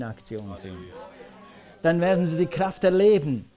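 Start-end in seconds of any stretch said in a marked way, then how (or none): none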